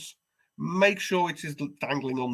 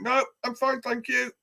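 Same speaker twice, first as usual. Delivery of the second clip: much higher, prim polite brush-off; politely but firmly wave them away; overly polite Brit; 10 semitones higher than usual. Retold or imitated. British patient brushing off the knocker, high prim politeness. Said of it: "the British thing of going".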